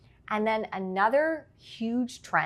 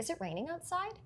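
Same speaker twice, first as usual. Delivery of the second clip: outside rising high at the end; question rise; 10 semitones higher, 10 dB quieter than usual. Shown as a demonstration. Coach demonstrating a question to her interviewer, rising on outside. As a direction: lift the pitch up on outside as a question. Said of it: "When we go up, we're asking a question".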